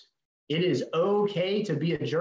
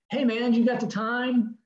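approaching stranger; 8 semitones higher than usual. Retold or imitated. Stranger approaching at gas pump.

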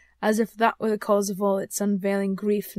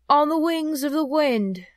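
slow, higher, louder phantom voice; eerie ghost; 6 semitones higher, 6 dB louder than usual. Acted. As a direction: draw it out like a spectre.

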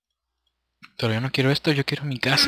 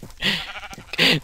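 gasp; 7 semitones higher than usual. Real, not acted. Sheep burns, gasps.

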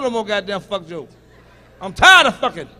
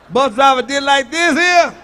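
normal talking voice

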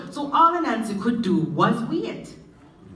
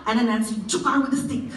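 ordinary delivery

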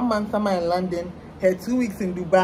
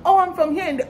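mocking imitation; 9 semitones higher, 4 dB louder, faster than usual. Mimics people dodging the question.

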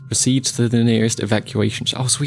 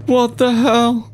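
high, slow cry of bafflement; stretched, incredulous wail; shocked disbelief; 9 semitones higher, much slower than usual. Real, not acted. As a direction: draw it out in high-voiced alarm. Baffled by evil paperclip.

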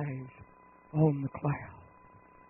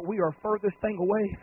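usual speaking voice

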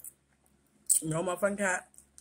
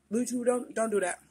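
ordinary talk

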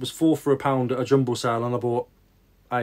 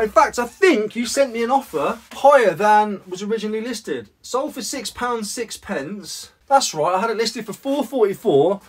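ordinary talk, nothing marked

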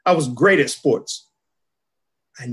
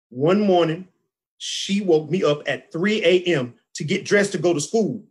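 ordinary talk, nothing marked

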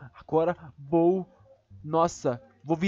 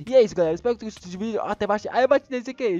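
usual speaking voice

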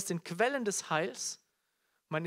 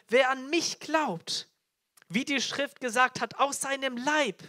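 raised, high proclamation; crying out; 5 semitones higher, 5 dB louder than usual. Acted. Jesus promises living water to believers, proclaiming loudly. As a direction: proclaim it phrase by phrase, high and strong. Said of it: "rief und sprach".

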